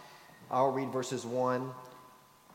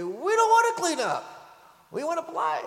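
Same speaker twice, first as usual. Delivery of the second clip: put-on much higher, louder kid's protest; whiny little boy; 13 semitones higher, 7 dB louder than usual. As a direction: whine like a boy who wants to keep playing. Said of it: "we heard one of our boys say".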